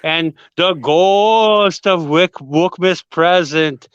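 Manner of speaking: slow, drawn-out spooky ghost voice. Acted. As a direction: moan it out like a haunting ghost. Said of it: "I'm trying out my ghost voice".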